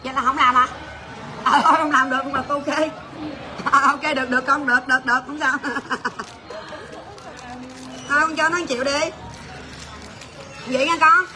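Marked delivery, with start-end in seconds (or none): 5.6-8.0 soft trailing laughter. Real, not acted. Host giving in to the crying worker, soft laughter. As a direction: let a long, quieter laugh run out.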